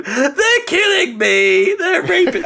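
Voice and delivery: high, drawn-out mock scream; hammed-up terror through the gate. Kurt Russell screaming through the Stargate, hammed-up high terror. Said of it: "Kurt Russell just going".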